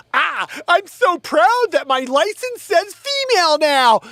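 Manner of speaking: high, loud, gushing mock voice; hammed-up high-pitched boasting; mocking proud poster. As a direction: drag it out in a loud high parody. Mocks a proud social media poster.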